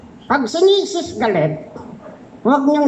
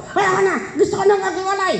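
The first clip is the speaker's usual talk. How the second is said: angry wife voice; 8 semitones higher, much faster than usual. Retold or imitated.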